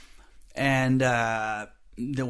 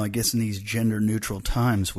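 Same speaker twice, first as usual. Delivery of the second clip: usual speaking voice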